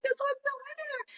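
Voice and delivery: very high, held-back mock scream; ecstatic squeal. Declaring the winning catch, ecstatic. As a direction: squeal it thinly without full volume. Retells announcing the winning fish.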